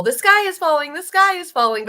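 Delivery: high loud mock alarm; mock panic. Mocking politicians crying wolf, high and loud mock panic. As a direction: cry it like a panicky chicken. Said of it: "It's like the whole".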